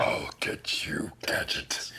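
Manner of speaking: slow raspy villain whisper; Doctor Claw. Retold or imitated. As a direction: hiss it like a cartoon villain. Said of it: "that's just fucking Doctor Claw".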